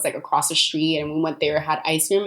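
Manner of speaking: ordinary delivery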